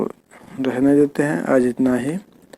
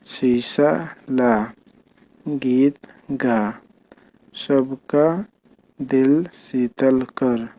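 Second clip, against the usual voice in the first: very slow, word by word; teacher reading the sentence slowly to the class; much slower than usual. Shown as a demonstration. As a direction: read each word slowly for children to follow. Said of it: "ठीक है, तो ऐसे पढ़ना है".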